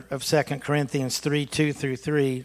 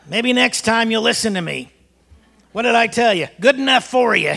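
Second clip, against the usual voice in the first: higher, louder smug told-you-so mom; mocking mother; 8 semitones higher, 8 dB louder than usual. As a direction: scold with glee, I told you so. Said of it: "And here's my mother".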